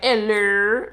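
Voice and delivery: high, loud sing-song hello with a rising end. Retold or imitated. New Orleans lady greeting him, imitated high sing-song hello. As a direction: put on a chirpy Southern lady's greeting. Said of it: "She's like".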